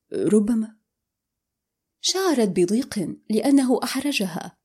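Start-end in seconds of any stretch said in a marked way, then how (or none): none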